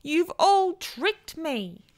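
high, slow wail of complaint; howled accusation. A narrator's reading. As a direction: wail it out, stretching the words. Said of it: "he howled".

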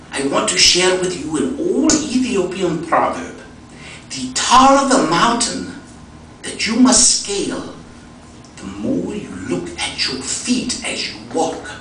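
put-on slow, deliberate Ethiopian accent, sharing a proverb; German de Sasa's accent. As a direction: imitate an Ethiopian friend preaching a little, drawn out. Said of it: "I do a pretty good German accent".

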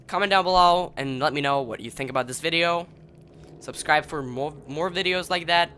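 ordinary delivery

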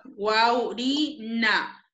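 guau, ri, na spoken as separate beats; syllable by syllable. Shown as a demonstration. Teacher correcting a student's syllable split, broken into beats. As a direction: break the word into guau, ri, na. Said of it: "vocal cerrada".

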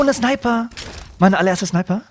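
high-pitched delighted exclamation; thrilled at a first find, voice jumping up; excited. Real, not acted. Finds his first sniper rifle.

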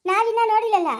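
ordinary talk, nothing marked

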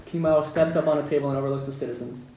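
ordinary delivery

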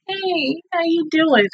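soft, high-pitched cooing hello; tender greeting. Real, not acted. Viewer greets Cody for comfort, softly cooing. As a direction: coo gently to a pet.

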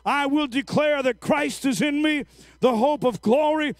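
rising into sustained loud, high declaration; full-force preaching. Real, not acted. Preacher modelling worship in spite of circumstances, full-force preaching. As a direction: drive each phrase up and out, shouting the last ones.

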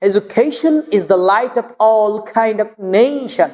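slow, with "Education" as e-ju-KHEI-shon; beautiful pronunciation. Shown as a demonstration. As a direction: stretch the ei in "Education" and "nation". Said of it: "কত সুন্দর লাগছে শুনতে".

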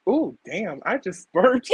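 ordinary delivery

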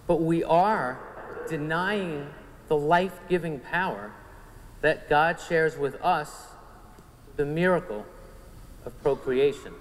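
normal talking voice

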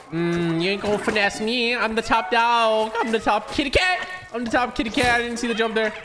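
high, singsong bragging; high-voiced top-cat swagger; cocky cat boast.

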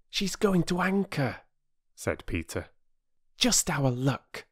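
a disappointed schoolboy voice; lightly voiced boy, grumbling; young boy. A narrator's reading. Peter grumbling to his sisters, mildly boyish. Boy's voice.

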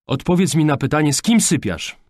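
angry father interrogating; impatient demand. A narrator's reading. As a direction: demand an answer at once.

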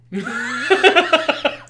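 a burst of high, loud laughter; real laugh at his own joke; cracking up. Real, not acted.

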